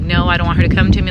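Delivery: loud, fast desperate plea; pleading. Retold or imitated. Begging coworker not to send grandma, loud fast plea.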